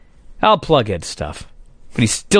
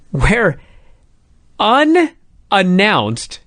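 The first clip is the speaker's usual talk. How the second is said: slow dramatic buildup; much slower than usual. Acted. Building up a big reveal.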